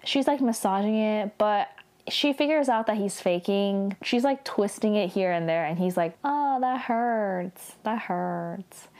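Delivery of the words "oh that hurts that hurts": slow, drawn-out mock moan; faked pain. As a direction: whine it out like a half-hearted faker. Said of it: "He's not even, like, pretending that hard".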